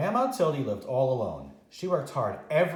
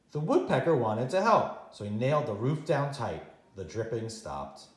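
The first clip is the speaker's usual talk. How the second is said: ordinary delivery, nothing marked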